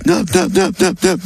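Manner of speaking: squealing pig counting in oinks. Acted. A farmer's pig oinking its answer. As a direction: grunt the numbers like a pig.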